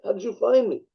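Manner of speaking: high-pitched calling out, as a quoted shout; retold yell. Card player calls to Sora from the bar's back, retold yell. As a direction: retell a startled yell across a bar. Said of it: "yells out".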